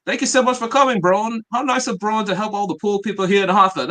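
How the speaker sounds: hammed-up wrestler impression, starting high and loud; Grayson Waller. Host quotes a wrestler thanking Braun for helping poor people, hammed-up impression. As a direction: pitch it up for a mock thank-you, easing off by the end. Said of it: "Waller said. He goes".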